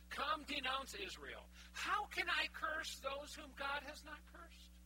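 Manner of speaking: slow, lifted oracle recitation; solemn slow reading of the oracle; scripture reading. Acted. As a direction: declaim it like prophecy.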